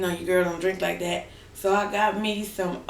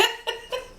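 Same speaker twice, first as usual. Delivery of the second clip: high giggle; 5 dB quieter than usual. Real, not acted. Laughing at own joke.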